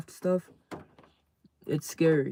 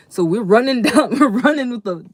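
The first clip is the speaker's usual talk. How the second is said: laughing; 8 semitones higher, 12 dB louder than usual. Real, not acted.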